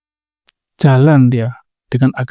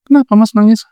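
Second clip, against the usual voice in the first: higher, louder mock-worried wife; concerned wife; 7 semitones higher, 5 dB louder, faster than usual. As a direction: ask your crying husband why, pitched up. Istri kaget melihat suami menangis. (istri bertanya cemas, nada naik)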